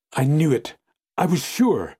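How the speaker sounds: high cry of triumph and pain; exulting cry. A narrator's reading. Fiancée hearing her name was last, exulting cry. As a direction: cry out high with anguished certainty. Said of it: "exulting and terrible cry".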